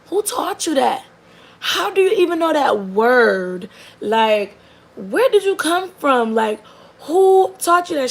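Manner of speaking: high, loud, incredulous questions; can't believe what the kid just said; stunned disbelief. Retold or imitated. Mother stunned by four-year-old's word.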